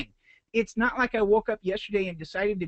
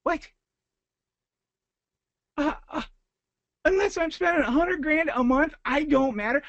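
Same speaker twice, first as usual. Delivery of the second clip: much higher, halting, incredulous; reeling from a rep's dismissive line; stunned disbelief; 9 semitones higher than usual. Retold or imitated. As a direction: repeat it back like you can't believe it. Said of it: "I'm like".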